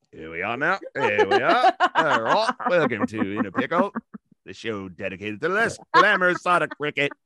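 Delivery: hammed-up British show intro, laughing; mock British accent. Co-host introducing a cricket show, mock British accent. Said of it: "a British accent the entire show".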